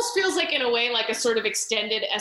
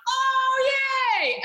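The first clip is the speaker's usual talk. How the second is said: much higher delighted yay; mock cheer; 13 semitones higher than usual. Viewer cheering the killer's first mission, much higher mock delight. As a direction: squeal a naive little yay. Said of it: "you're like".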